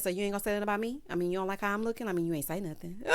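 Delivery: rattled off quickly; imitated needy groom. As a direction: rush it out, fishing for a compliment. Voicing groom fishing for compliments.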